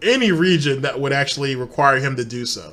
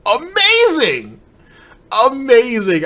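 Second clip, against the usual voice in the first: loud, high, drawn-out exclamation; mock amazement; 10 semitones higher, 8 dB louder, slower than usual. Real, not acted. Reacting to the commentator's absurd claim, loudly incredulous. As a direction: stretch it out slowly, pitch soaring then falling.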